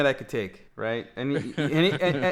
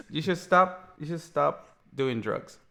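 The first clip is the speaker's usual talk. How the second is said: usual speaking voice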